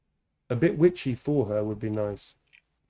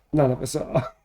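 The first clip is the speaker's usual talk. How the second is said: laughing; 5 dB louder than usual. Real, not acted. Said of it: "taken on a whole new meaning now".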